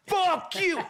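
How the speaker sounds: furious high-pitched scream. Retold or imitated. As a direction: scream it at the top of the range. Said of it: "screaming in a way I can't even scream".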